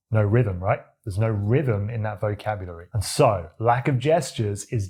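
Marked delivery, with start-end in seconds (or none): none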